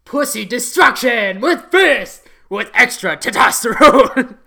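loud, high macho shout with laughter; hyped shout. Acted. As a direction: bellow it like a hype-man.

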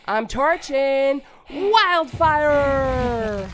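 loud, slow battle cry; attack shout. Acted. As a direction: belt out the attack.

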